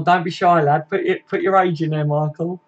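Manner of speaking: normal talking voice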